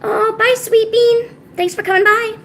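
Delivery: high, sing-out goodbye; sweet farewell. Real, not acted. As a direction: call a fond goodbye in a high voice. Sweet farewell to departing viewer.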